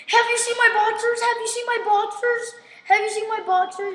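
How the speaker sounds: high-pitched pestering prank voice; mock-frantic asking. Boy pranking his teacher about lost underwear, mock-frantic. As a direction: ask it high and urgent, over and over.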